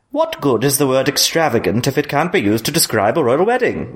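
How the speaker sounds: a dry, disdainful dowager's remark; Lady Olenna impression. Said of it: "That's Lady Olenna".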